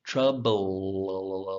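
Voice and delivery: "Troubled" with the l held very long before the d; held L sound. Shown as a demonstration. Teacher shows tongue position, prolonged L. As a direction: stretch the l in "Troubled" on a low tone. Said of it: "the tip is making the L sound".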